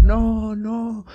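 high panicked cry of no; girls screaming no. Retold or imitated. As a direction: squeal it like scared little girls. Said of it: "y las niñas gritaban".

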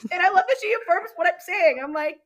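high, amused delivery through laughter; laughing while talking. Real, not acted. Client fondly telling how her therapist affirms her, laughing. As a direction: laugh along as you talk, pitched well up.

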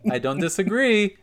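high, loud, drawn-out impression; Nene Leakes. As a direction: imitate a reality star's slow, emphatic agreement. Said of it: "And Nene's just like".

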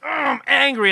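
high loud mock-angry whine. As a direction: pout it out angrily. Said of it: "my kid would be like".

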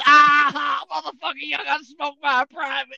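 loud, high jeering shout; mocking shout. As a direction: bust in and jeer at them. Busting in to mock.

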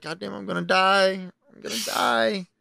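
high wailing lament, drawn out at the end; mock dread. Following his girl into the woods, mock dread. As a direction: play up comic doom, slowing down.

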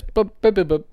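playful high-pitched boops; sing-song boop boop; high boop noises. Retold or imitated. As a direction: toss off cheerful boop sounds. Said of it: "he's like".

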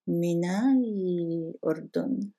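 من الأردن linked, the l held long; skipped alif hamza. Shown as a demonstration. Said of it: "we skipped this alif hamza". (من الأردن joined without a break before the l)

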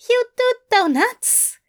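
very high, strangled alarm. Acted. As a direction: squeak it out strangled. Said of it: "in a strangled tone".

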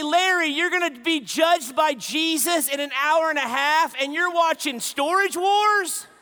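loud, high, slowed disbelief; incredulous outburst. Retold or imitated. Preacher shouting his disbelief at the dying neighbor's TV habit. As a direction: burst out in amazed disbelief. Punchline of sermon story.

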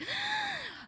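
sharp high gasp of panic; retold gasp. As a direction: suck in air like waking up scared. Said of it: "and I was like".

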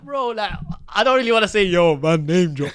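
bragging lightly while acting coy; excited. Telling a friend how he answered the lads, loud and high. Retelling his own reply, hyped.

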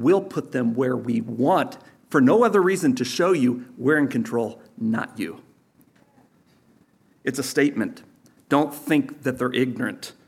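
ordinary talk

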